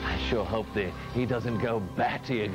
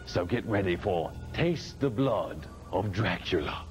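ordinary talk, nothing marked

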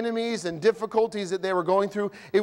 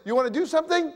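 high, light coaxing wife; wife nudging her grumpy husband, pitched high; wife asking; 8 semitones higher, faster than usual. Retold or imitated. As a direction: coax a sulking husband gently. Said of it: "your wife says".

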